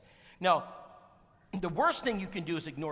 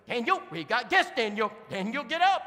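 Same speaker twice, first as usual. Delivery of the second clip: loud, higher calls to wake him; calling husband; 6 semitones higher, 8 dB louder than usual. Retold or imitated. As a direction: shout the husband awake. Wife waking husband on couch.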